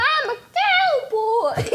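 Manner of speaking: high, laughing announcement; laughing cowboy shout. Pretend. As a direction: blurt it out through giggles. Silly declaration with laugh.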